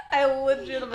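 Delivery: fast, laughing and high. Real, not acted. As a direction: gush through laughter. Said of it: "I literally have goosebumps".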